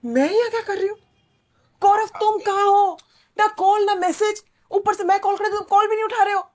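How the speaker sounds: worked-up, accusing tone; angry yelling. Acted. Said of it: "तुम चिल्ला क्यों रही हो".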